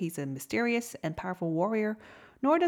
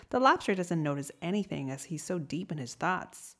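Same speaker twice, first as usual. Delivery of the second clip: usual speaking voice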